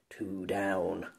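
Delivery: unvoiced whispered aside; low secret whisper of satisfaction. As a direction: breathe it to yourself, voiceless. Pierre alone, whispered.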